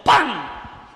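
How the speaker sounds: loud sharp spoken slap noise; slap sound. Retold or imitated. Preacher imitating a slap on God's face, loud. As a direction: smack the word out like a hand strike.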